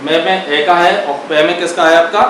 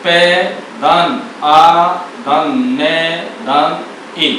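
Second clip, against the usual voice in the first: slow, letter-by-letter breakdown; spelled out slowly; much slower than usual. Shown as a demonstration. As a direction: split sound by sound. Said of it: "वर्ण कितने हो जाएंगे". (धीरे-धीरे, एक-एक ध्वनि अलग करके)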